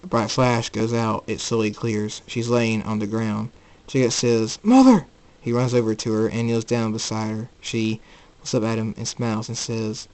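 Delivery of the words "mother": loud, high-pitched call; alarmed cry. Acted. Jacob seeing his mother on the ground, crying out.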